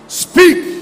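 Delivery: high, loud preacher's shout; shouted command. Real, not acted. Preacher urging young men and young ladies, shouted command.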